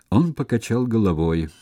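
ordinary delivery

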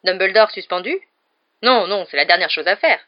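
alarmed. A narrator's reading. Fudge panics at suspension.

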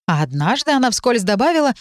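usual speaking voice

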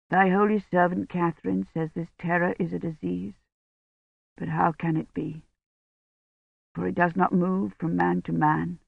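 soft, fading prayer to Jesus; hushed prayer. A narrator's reading. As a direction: pray quietly, almost to yourself. Priest prays after vesper prayers.

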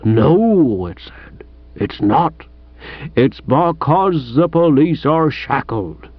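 slow, weighty quoting of a newspaper; aggrieved police sergeant. Acted. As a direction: recite it slowly as an indignant sergeant.

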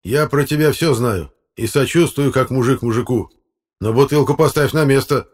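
loud, high-pitched insistence; defiant. Acted. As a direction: stand up to the boss, loudly. Defiant electrician argues with chairman. (напористо, с вызовом)

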